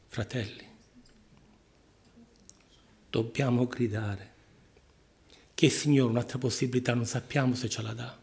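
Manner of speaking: ordinary talk, nothing marked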